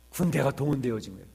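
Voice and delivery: ordinary delivery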